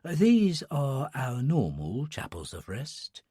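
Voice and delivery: put-on soft, hushed old attendant; a sleepy old man whispering politely; quiet old man voice.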